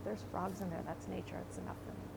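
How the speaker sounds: softly, fading to a whisper; a hushed little satisfied aside; quiet contentment. Retold or imitated. Looking at the empty lot, quietly content to herself. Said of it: "the empty lot and I go".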